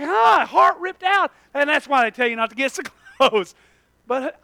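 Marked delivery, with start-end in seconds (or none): 0.0-0.5 mock gasp. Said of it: "You're like".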